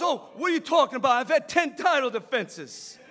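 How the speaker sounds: loud, fast boxer's retort; indignant protest. Retold or imitated. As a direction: protest loudly, defending your record. Acted quarrel, boxer protesting.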